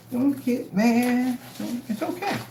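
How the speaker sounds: put-on high, drawn-out babying; singsong fake comfort; mock consoling. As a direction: soothe them tauntingly. Mock consoling after gloating.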